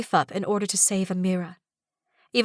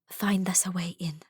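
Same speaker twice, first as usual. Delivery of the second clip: secretive whisper; hushed order to the snake; 5 dB quieter than usual. Acted. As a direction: whisper it at the door crack. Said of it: "I whisper".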